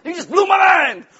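loud, high shout of amazement; Kramer. Acted. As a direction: explode in stunned sitcom astonishment. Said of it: "Kramer walks in".

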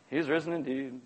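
low, soft mumbled reply; unenthusiastic response. Acted. Men replying to the excited women, subdued and grudging. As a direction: answer dutifully, with no excitement.

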